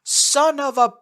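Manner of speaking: high, loud, slow shout of surprise. A narrator's reading. Narrator shouts on seeing an old buddy. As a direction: yell it out in shock. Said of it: "I shouted".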